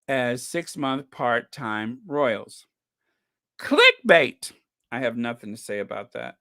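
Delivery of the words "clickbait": loud high scoffing shout. Real, not acted. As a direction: yell it out in scorn. Mocking a tabloid headline.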